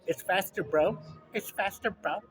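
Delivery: high mock ad chorus; commercial voice. Imitating the Flonase ad crowd. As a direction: chant it like the people in the ad. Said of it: "and they're like".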